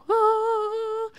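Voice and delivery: high, breathy-free awestruck "Ah" way up in the head; gawking men. Retold or imitated. Imitating men who stare at her on the street, high awestruck gasp. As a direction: imitate a man struck dumb by a beautiful woman. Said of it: "And they're just like".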